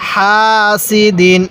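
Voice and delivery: slow, syllable by syllable হাসিদিন; slow recitation. Shown as a demonstration. Teacher reading the word slowly for students to copy. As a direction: draw each syllable out carefully. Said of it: "এরকমভাবে শিখব".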